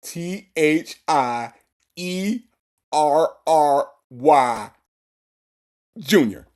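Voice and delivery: mock his laboured spelling, one drawn-out letter at a time. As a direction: spell it out slowly with long pauses. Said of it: "That's how he said it".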